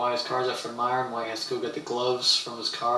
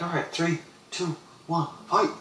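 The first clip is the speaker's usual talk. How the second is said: counting down higher like a referee; fight countdown; 5 semitones higher than usual. Retold or imitated. Tim starting the backyard fight, higher countdown. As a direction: call the count to start the bout.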